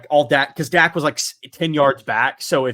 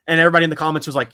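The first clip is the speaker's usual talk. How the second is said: normal talking voice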